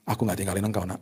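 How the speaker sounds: soft and gentle, a hint of God speaking; softly voiced God reassuring his child; tender voice of God. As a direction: comfort a frightened child softly. Voicing God comforting him.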